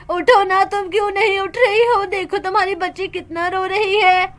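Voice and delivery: ordinary talk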